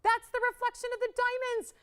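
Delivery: high and bright with wonder, lightly slowed; host gushing over light on her skin; delighted awe. Real, not acted. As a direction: marvel at the sparkle as if seeing it fresh. Host marvels at sparkle on skin.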